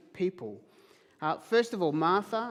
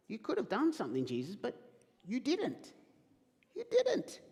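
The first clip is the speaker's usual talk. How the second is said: disappointed rebuke; 6 semitones higher than usual. Acted. Martha rebuking Jesus for arriving too late, reproachful. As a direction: let the disappointment sting on the last words. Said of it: "but here's the disappointment".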